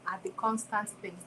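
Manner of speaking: ordinary talk, nothing marked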